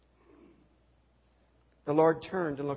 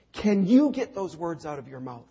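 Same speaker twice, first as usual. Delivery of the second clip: louder, pointed demand; pressing challenge; 8 dB louder than usual. Acted. Council pressing Jesus to confess, a loud pointed challenge. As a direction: push him hard to say it.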